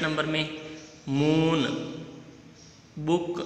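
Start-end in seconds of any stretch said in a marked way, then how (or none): 1.1-1.6 "मून" with a held oo; long oo. Shown as a demonstration. Teacher sets up odd-sound quiz, long oo in "मून". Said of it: "कौन से का sound अलग आ रहा है". 3.0-3.4 "बुक" with a brief u; short u. Shown as a demonstration. Teacher gives the odd sound out, short u in "बुक". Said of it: "बुक का".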